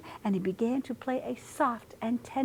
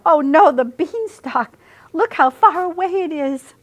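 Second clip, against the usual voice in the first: loud dismay; 8 semitones higher, 8 dB louder than usual. Acted. Cat dismayed.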